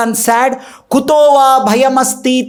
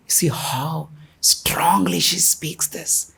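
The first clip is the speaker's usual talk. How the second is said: whisper; 6 semitones lower, 9 dB quieter, slower than usual. Real, not acted. Hushed aside on Sita's words.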